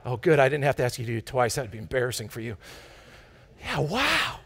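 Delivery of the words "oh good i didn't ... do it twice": normal talking voice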